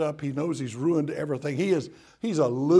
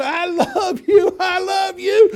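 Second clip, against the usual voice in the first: high, drawn-out doting shout; joyful yelling; 10 dB louder, slower than usual. Acted. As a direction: play a granddaddy hollering love at full volume. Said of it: "he starts yelling".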